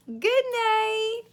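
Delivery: high goodnight. Acted. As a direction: lilt it way up.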